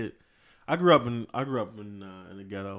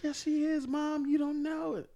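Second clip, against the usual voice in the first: put-on higher child's voice insisting; little daughter; 10 semitones higher, slower than usual. Young daughter insisting to her mom, higher child voice. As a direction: pitch up and insist like a small girl. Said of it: "my daughter's like".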